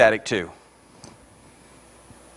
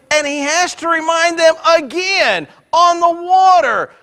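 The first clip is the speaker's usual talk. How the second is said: high, driving pulpit cry; full-force preaching; 12 semitones higher than usual. Real, not acted. Preacher to congregation on the disciples' lack of faith, impassioned preaching. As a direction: build with insistent repeated phrases.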